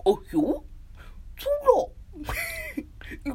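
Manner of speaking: goofy cartoon yelp, swooping up. Streamer showing her confident impression, goofy cartoon hoot. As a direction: hoot it with a rising end. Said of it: "グーフィーのモノマネ".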